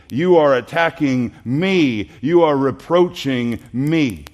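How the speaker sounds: loud, slow, each "me" hammered down; preaching at full force. Acted. Preacher voices Jesus to the congregation, loud and slow. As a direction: drive it home like a pulpit cry.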